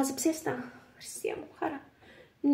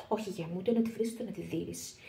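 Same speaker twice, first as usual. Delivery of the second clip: soft hushed whisper; 4 semitones lower, 7 dB quieter than usual. Acted. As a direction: whisper the advice close. Soothing son secretly.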